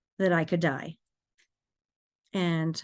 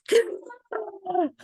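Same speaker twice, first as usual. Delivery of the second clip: gasping breath; 13 semitones higher than usual. Shown as a demonstration. Driver coming through after a near-fatal crash, gasping breath. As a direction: gasp as if surfacing from underwater. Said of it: "this gasping breath".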